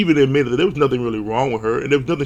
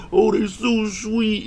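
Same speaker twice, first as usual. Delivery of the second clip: high, slow cooing over a cute couple; mock gushing viewer; 8 semitones higher, slower than usual. Viewer who skipped the season coos at the couple. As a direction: coo it like a smitten fan. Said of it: "It would be like this".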